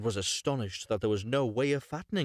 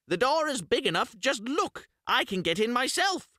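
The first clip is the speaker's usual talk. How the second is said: much higher, louder scoffing witch; scornful witch; 13 semitones higher, 4 dB louder than usual. Acted. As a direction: scoff at the dull girl. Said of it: "said the old woman".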